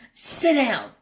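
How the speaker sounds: angry owner yelling at his dog; sharp command. Retold or imitated. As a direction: snap it at the dog. Scolding the dog after smack.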